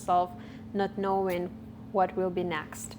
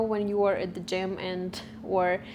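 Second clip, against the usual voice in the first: ordinary talk, nothing marked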